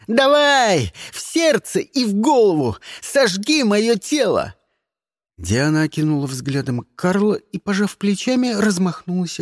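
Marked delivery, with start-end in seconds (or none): 0.1-4.5 loud, high desperate goading; yelling. A narrator's reading. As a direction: dare him to fire, yelling. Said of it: "заорал он". (истошно орёт, требуя выстрела)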